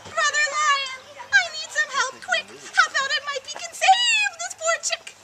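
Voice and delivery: high, loud desperate chick calling; frantic high plea; crying out for help. Acted. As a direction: cry out to Brother Lion. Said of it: "cried out".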